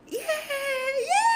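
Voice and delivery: very high cracked yeah yeah; strained scream. Pretend. Streamer screaming on request, very high and cracking. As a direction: push the voice till it cracks. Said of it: "See, my voice went hoarse".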